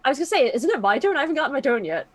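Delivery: ordinary delivery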